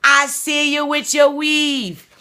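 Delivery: high, fast imitation of a Trinidad and Tobago accent; playful Trinidad and Tobago accent, slowing on the last line. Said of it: "Trinidad and P-, Tobago accent".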